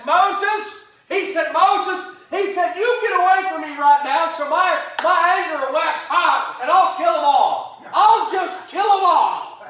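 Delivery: shouted, high and loud, I'll kill 'em all; a furious God bellowing at Moses; angry God. Acted. Preacher playing God raging at Moses, full-throated shout. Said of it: "He was mad".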